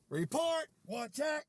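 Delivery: high, loud, short calls; mock command shout. Commander calls for a report, high mock shout. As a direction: shout it out like a drill order.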